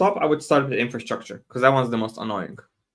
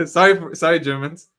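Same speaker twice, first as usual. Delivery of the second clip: laughing apology; 3 semitones higher, 6 dB louder than usual. Real, not acted.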